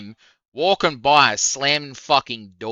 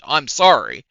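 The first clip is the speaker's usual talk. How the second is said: ordinary talk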